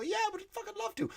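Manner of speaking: high, fast squeal of fake enthusiasm; sarcastic falsetto yes; mock eager reply. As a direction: squeak it out all too keen. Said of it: "And I go".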